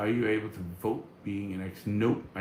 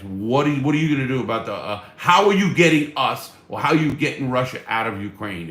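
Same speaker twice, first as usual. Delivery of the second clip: heated, loud questioning building to a high shout; worked-up anger; 5 semitones higher, 10 dB louder than usual. Acted. Streamer demanding a straight debate answer from the candidate, increasingly angry. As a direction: demand an answer, louder and higher until you are shouting. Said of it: "Damn, get me mad".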